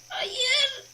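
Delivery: high, loud frustrated shout. Real, not acted. Gamer shouting no at the failing jump. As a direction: yell it at the screen.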